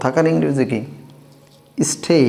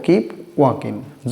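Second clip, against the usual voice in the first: slow model phrase; 3 semitones lower, 3 dB quieter, much slower than usual. Shown as a demonstration. Teacher drilling learners, very slow model phrase. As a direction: keep walking stretched out very slowly. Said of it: "teacher models phrase slowly".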